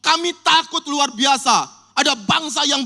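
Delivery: high, slow, drawn-out frightened plea; fearful cry for help. Acted. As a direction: beg God for rescue, terrified. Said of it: "mereka teriak".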